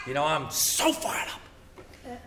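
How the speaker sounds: slow, soft, hammed-up fired-up; drawn-out mock enthusiasm; mock zeal. Voicing a zealous believer.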